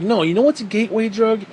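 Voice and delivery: ordinary talk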